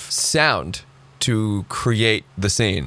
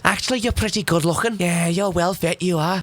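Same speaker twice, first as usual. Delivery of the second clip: higher-pitched mock Scouse voice; Scouse accent; 7 semitones higher than usual. Imagined hottest woman flattering him, mock Scouse accent. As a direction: put on a Liverpool accent, pitched up. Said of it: "If she had a Scouse accent".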